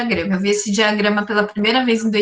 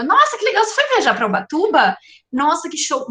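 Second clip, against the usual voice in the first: high, gushing "Nossa, que legal"; excited friend; 6 semitones higher than usual. Acted. Friend jumps in on your Ubatuba story, gushing excitedly. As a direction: pitch way up, gush like an eager friend.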